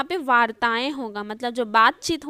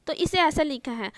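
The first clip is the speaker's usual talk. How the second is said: ordinary delivery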